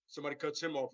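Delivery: usual speaking voice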